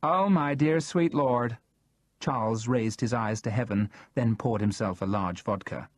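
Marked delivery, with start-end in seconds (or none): 0.0-1.6 exasperated. A narrator's reading. Charles raises eyes to heaven.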